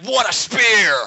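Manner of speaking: high, loud commentator shout. Acted. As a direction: holler it like a wrestling play-by-play call. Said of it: "That's commentator".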